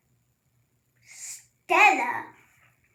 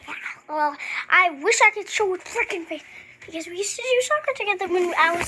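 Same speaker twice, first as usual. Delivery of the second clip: ordinary talk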